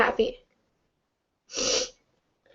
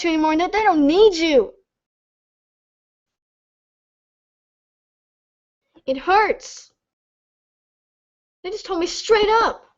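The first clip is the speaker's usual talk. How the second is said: tearful; 4 semitones higher than usual. Real, not acted. Tearful outburst about being dropped.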